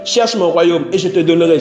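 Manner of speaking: usual speaking voice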